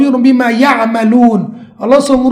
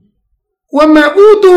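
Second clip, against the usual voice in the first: very slow, loud, high chant; drawn-out recitation; 5 semitones higher, 7 dB louder, much slower than usual. Shown as a demonstration. Teacher quoting the rejected reading to students, slow loud chant. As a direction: stretch "วะมาอูตู" out like a chanted verse. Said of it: "ไม่นับถือเลย".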